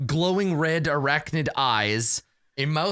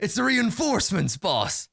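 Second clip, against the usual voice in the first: higher-pitched Cockney henchman; Cockney bugbear; 7 semitones higher than usual. Acted. Bugbear tells boss of reinforcements, in higher-pitched Cockney. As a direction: report to the boss in a Cockney accent. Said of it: "I forgot bugbears are Cockney".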